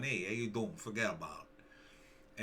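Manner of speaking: a mock New Jersey tough guy, loose and easy; Jersey accent. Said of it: "without a Jersey accent".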